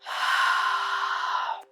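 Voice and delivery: slow, drawn-out airy exhale; empty the lungs in one long breath; long releasing sigh. Shown as a demonstration. Models a deep releasing breath.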